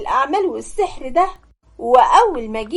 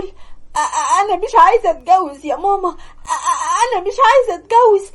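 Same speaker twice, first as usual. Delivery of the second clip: breaking down sobbing; 4 semitones higher than usual. Acted. Hanan breaks down crying, refusing marriage to her mother. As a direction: cry it out, choking on the first sounds. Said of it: "انهارت من العياط وقعدت تقول".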